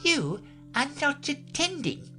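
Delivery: high-pitched scolding Mouse; severe. A narrator's reading. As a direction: scold sternly in a high little voice. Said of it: "said the mouse to Alice severely".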